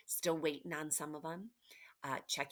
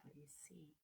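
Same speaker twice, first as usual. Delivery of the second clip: soft whispered question, much quieter; 20 dB quieter, much faster than usual. Acted. Teacher whispering a question to her students, hushed. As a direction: lean in and whisper it.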